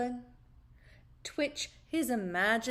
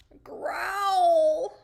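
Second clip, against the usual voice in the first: loud, higher roaring growl; terrible growl; 7 semitones higher, 9 dB louder than usual. A narrator's reading. Narrator voicing the lion's growl, loud and terrible. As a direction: make it a fearsome lion growl. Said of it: "a great and terrible growl".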